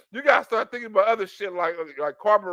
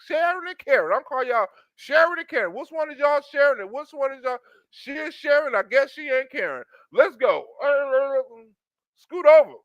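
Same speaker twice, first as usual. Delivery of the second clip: hammed-up eager; 7 semitones higher than usual. Man demanding to join, high eager voice. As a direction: squeeze in eagerly. Continuing the excited bit.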